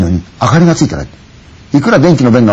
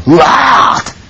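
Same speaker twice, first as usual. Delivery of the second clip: sobbing wail; 4 semitones higher, much slower than usual. Acted. Crying at the driver.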